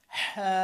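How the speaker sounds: long হা squeezed from mid throat; pressed throat h. Shown as a demonstration. Teacher asking learners to try along, pressed throat h. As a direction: push হা through a tightened throat. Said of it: "একটা চাপ দিবেন".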